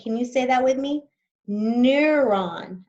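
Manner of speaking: ordinary talk, nothing marked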